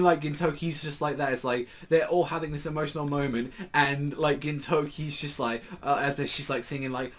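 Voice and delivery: ordinary delivery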